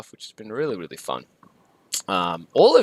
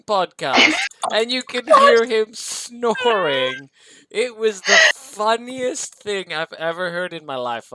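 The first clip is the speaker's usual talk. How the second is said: laughing; 9 semitones higher, 5 dB louder, slower than usual. Real, not acted. Retelling hilarious podcast moment.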